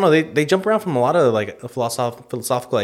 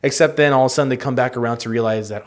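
ordinary talk, nothing marked

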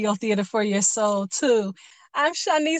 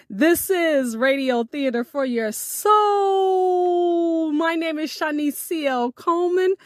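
announcer sign-off; 5 semitones higher, slower than usual. Acted.

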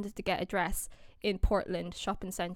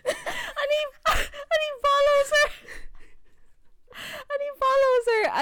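very high, squealing through laughter; real helpless hysterics; laughing to tears; 4 dB louder, slower than usual. Real, not acted. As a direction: try to continue while cracking up. Cannot finish story for laughing.

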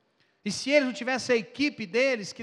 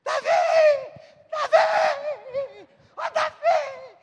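high, loud frantic calling; mother's frantic shout; 11 dB louder, much slower than usual. Retold or imitated. Preacher playing the mother calling her son across the club, high frantic shout. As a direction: scream the boy's name like a panicked mother. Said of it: "E a mãe sai correndo".

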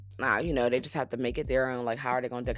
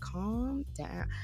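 high calm down; 7 semitones higher, 10 dB quieter than usual. Retold or imitated. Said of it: "And I'm like".

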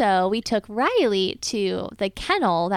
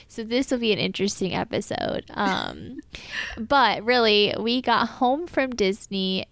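usual speaking voice

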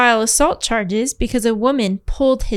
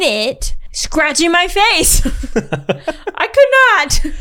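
laughing; 5 semitones higher, 5 dB louder, much slower than usual. Real, not acted. Laughing at own fear.

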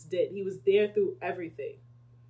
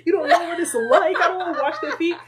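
higher, laughing complaint to the Lord; whiny disciple; 9 semitones higher, 6 dB louder than usual. Pretend. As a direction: whine like a spoiled disciple refusing the chore. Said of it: "So this should be no reason".

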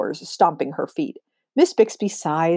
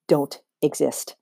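fake relief; 3 semitones lower, slower than usual. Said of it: "her voice tinged with fake relief".

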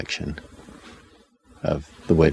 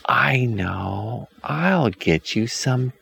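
put-on high, slow, sugary old woman; sweet witch voice; 7 semitones higher, much slower than usual. A narrator's reading. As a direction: coax them in a sickly sweet voice. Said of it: "the woman says".

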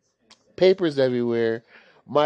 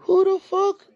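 squeaky high disbelief. Retold or imitated. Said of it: "I'm like".

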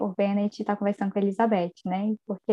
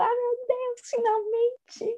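much higher, soft squeal of relief; mock joyful squeal; 12 semitones higher, 3 dB quieter, slower than usual. Podcaster acts out her delight at the proposal, squealing. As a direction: gush "finalmente" like a thrilled fan. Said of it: "vontade de sair gritando".

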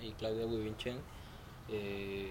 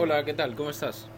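loud, hearty hello; louder greeting; 2 semitones higher, 7 dB louder than usual. Real, not acted. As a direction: greet them louder this time. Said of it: "Más fuerte, más fuerte".

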